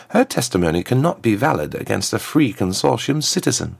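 high, smooth voice of a formal diplomat; a smooth ambassador, pitched well up; smooth contralto ambassador. A narrator's reading. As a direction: address royalty smoothly in a high-set voice. Plays the ambassador.

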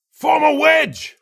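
high, loud battlefield yell; yelled command. A narrator's reading. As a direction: bark the order across the line. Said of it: "Lassane yelled".

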